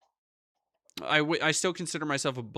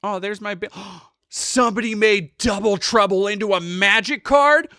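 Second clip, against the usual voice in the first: gasping, then a high, loud delighted rise on card; thrilled to suddenly find the card; surprised gasp; 8 semitones higher, 10 dB louder than usual. Real, not acted. Streamer spotting Double Trouble on a card, gasping with delight.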